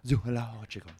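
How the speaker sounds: a deliberately bad, made-up zoologist accent on "Zoological", lightly done; mock scholarly accent on the word "Zoological". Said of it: "a bad one".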